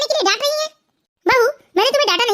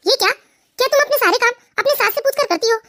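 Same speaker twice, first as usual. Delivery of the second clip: ordinary talk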